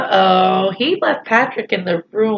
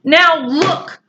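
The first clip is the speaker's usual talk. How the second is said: shouted; 9 semitones higher, slower than usual. Acted. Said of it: "he shouted at Patrick".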